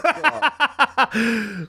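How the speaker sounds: high laughing groan. Real, not acted. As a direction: laugh out the word. Laughing exclamation.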